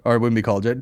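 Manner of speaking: ordinary talk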